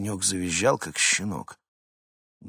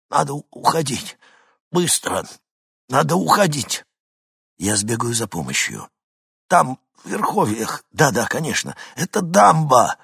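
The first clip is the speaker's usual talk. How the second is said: breathless, higher, louder urging; panicked; 7 semitones higher, 7 dB louder than usual. A narrator's reading. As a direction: urge them to flee, halting. Hinkin panicked, struggling.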